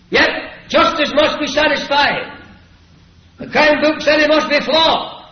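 high, loud preacher's declamation; stern schoolmaster pronouncing judgement. Acted. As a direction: proclaim the verdict at full pulpit force. Preacher voices doctor at full force.

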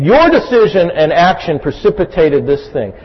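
usual speaking voice

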